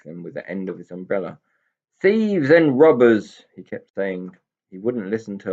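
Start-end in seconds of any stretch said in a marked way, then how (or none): 2.0-3.5 higher, louder and slow, spitting out the insult; angry shout. A narrator's reading. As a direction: shout the accusation at the pixies. Goblin rants at rowing pixies.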